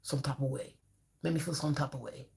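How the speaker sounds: soft, low "some type of way", mumbled and vague; mock client, mumbled. Therapist mimics a client avoiding emotions, soft and mumbled. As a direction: trail off like someone dodging the question. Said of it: "Let's talk emotion".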